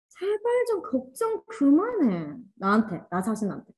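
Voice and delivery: high, slow pleading plea to oneself; imitating a high, slow, begging inner voice; pleading with yourself. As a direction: beg yourself to stop worrying, drawn out. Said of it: "이렇게 하는 거야".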